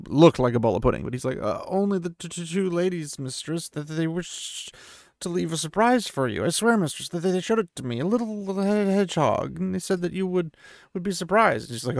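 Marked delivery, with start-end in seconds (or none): 1.4-11.7 high, stuttering, terrified innkeeper; frightened innkeeper pleading with Moraine, high and stammering. A narrator's reading. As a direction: stammer out excuses, pitched up in fear. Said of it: "a frightened Jara Herod".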